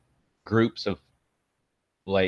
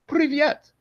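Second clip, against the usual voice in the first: Russian greeting; 4 dB louder than usual. Pretend. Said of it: "just be like".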